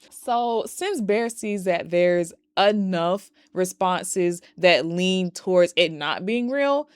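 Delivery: normal talking voice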